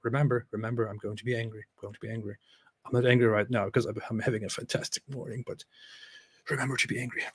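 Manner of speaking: whispered-down pep talk to himself; quiet mock mutter reminding himself to be angry. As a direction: coach yourself under your breath. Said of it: "But he thinks".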